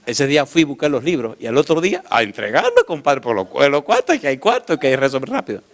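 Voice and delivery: usual speaking voice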